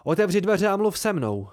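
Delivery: loud and high, pounding on the door; breathless yelled demand; hollering. A narrator's reading. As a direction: shout through the closed door. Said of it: "hulákal jsem".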